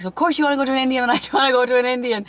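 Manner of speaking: hammed-up grumbling protest; mock whiny sulk. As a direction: rattle it off like a sulky teen, half laughing.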